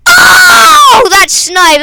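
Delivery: very high, loud oh of wonder; watching a teammate's snipe, cry out amazed; amazed shout. Real, not acted. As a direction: cheer a perfect snipe. Reacting to a great shot.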